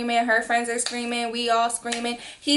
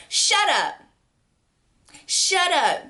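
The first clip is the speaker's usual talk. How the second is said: sharp, raised shut up; annoyed snap; 7 semitones higher, slower than usual. Retold or imitated. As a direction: cut him off, irritated. Snapping at the lying friend.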